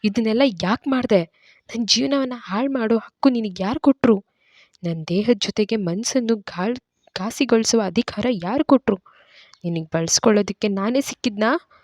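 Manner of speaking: mild narrated fury mixed with hurt; angry hurt outburst. A narrator's reading. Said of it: "ಕೋಪ, ನೋವು ಬೆರೆತ ಆವೇಶದಿಂದ ಜೋರಾಗ್ ಕೂಗ್ತಾಳೆ".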